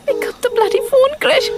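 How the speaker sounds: high, frustrated urging into the phone; exasperated. Acted. Woman cursing an unanswered call, exasperated. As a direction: let impatience push the pitch right up.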